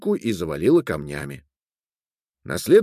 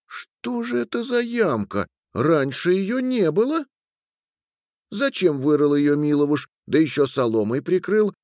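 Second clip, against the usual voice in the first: puzzled wife; 6 semitones higher than usual. A narrator's reading. Wife wondering why her husband dug the pit, high and puzzled. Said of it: "думала Катри".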